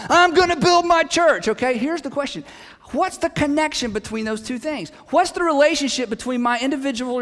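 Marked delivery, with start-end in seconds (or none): 0.1-1.5 raised higher and louder, declaring it; emphatic Jesus line. Retold or imitated. Preacher voicing Jesus to the congregation, emphatic and raised. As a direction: proclaim it like a promise no one can stop.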